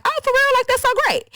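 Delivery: high, fake delighted gasp; mock surprise. The friend fakes delight at the news, high and fake surprised. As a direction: squeal it with fake excitement. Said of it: "she was like".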